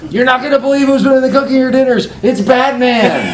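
loud announcement. Retold or imitated. Announcing to rowdy dining hall.

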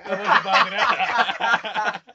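drawn-out words through laughter; slow laughing mock. Real, not acted. Teasing his friend about loving the homeland, slow laughing mock. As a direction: stretch it out while laughing.

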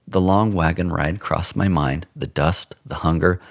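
ordinary delivery

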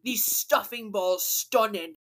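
hammed-up British foodie; put-on British, slightly Australian-sounding; mock British accent. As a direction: relish it in a bad British accent. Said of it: "That sounds more Australian".

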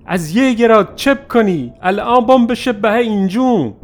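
put-on high, loud warning shout at the boy; retold yell. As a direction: yell at him to stop firing. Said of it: "داد زدم سرش". (فریاد بلند و هشداردهنده)

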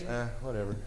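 low, soft verbal shrug; apathetic. Shown as a demonstration. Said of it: "What's apathy?".

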